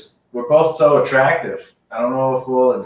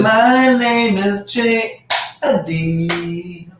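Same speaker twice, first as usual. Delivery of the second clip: announcer voice; 7 semitones higher, much slower than usual. Pretend. Drawn-out announcer intro.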